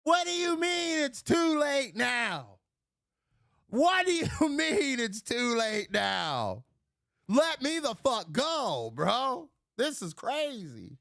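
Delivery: drawn-out, high, exasperated shouting; outraged yell. Retold or imitated. Man yelling at the cop who won't release him, outraged. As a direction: wail each slow word in mock outrage.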